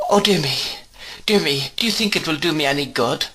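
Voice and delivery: tearful, half-whispered plea, rushing; helpless crying. A narrator's reading. Frightened mother asking Miss Betsy about tea, helpless and tearful. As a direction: cry it out helplessly. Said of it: "cried my mother in a helpless manner".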